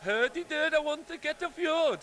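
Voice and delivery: high, sing-song mock Swedish; hammed-up Swedish chef voice; Swedish accent. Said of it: "I can do Swedish too".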